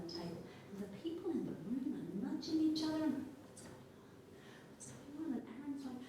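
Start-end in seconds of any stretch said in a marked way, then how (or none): 3.5-4.1 hushed whisper to a neighbour; whispered gossip. Acted. People nudging each other. 4.7-5.4 soft, higher murmur to a neighbour; murmured question. Acted. People nudging each other.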